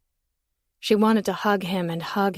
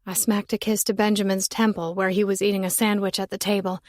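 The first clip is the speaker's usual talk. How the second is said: plain reading voice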